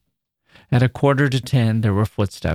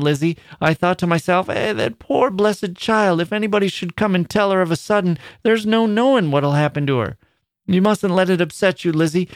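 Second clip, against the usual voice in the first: much higher, rambling Midlands grandmother; frightened old woman; 7 semitones higher than usual. A narrator's reading. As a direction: tell the news in a frightened rush. Grandmother voice held.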